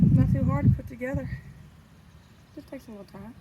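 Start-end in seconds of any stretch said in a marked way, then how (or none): 0.0-1.3 raised voice against the wind; shouting over wind. Real, not acted. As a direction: push it over the gusts. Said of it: "I hope you could hear me over the wind".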